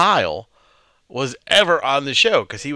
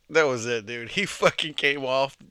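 normal talking voice

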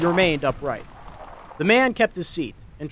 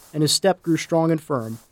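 ordinary delivery, nothing marked

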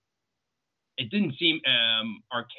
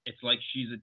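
usual speaking voice

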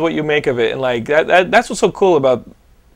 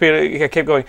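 normal talking voice

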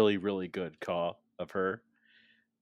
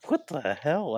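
high, louder stunned outburst; surprised; 7 semitones higher, 5 dB louder than usual. Retold or imitated. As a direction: blurt out in disbelief. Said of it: "It's like".

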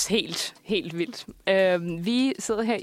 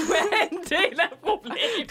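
higher, laughing repeat of the accusation; laughing echo; 8 semitones higher than usual. Real, not acted. Host echoing the cohost's jab while laughing. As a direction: laugh it back at her.

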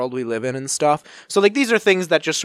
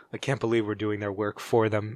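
normal talking voice

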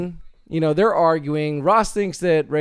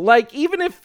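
ordinary talk, nothing marked

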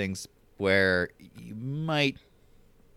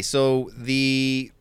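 normal talking voice

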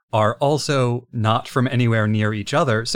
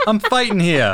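put-on higher, louder, laughing Brooklyn accent; 9 semitones higher, 4 dB louder than usual. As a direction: complain like a Brooklyn cabbie. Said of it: "They're all from Brooklyn".

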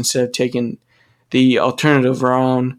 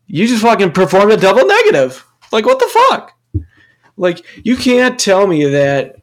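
much higher, louder indignant outburst; outraged; 6 semitones higher, 4 dB louder than usual. Real, not acted. Ranting about the teacher's double negative, loud outrage. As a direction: blow up in disbelief.

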